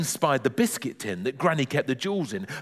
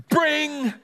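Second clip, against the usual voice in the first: high, held "bring" of a doorbell; insistent doorbell; 14 semitones higher than usual. A narrator's reading. Neighbour leaves finger on the buzzer, insistent high ring. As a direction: ring longer and more insistently. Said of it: "This ring was more insistent".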